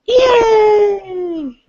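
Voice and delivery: high loud yay; cheering. Real, not acted. Cheering the news segment.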